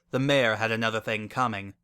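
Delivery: usual speaking voice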